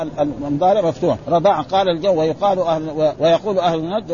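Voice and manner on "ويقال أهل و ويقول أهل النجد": normal talking voice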